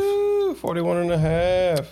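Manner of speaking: high whooping cheer; mock celebratory cheer; excited. Real, not acted. Cheering the half episode.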